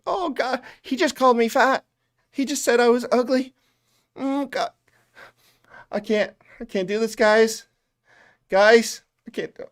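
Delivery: high, hammed-up whimper; mock tearful whining. Mocking streamer who quits over trolls, high tearful whine. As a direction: whine like a wounded, overdramatic streamer.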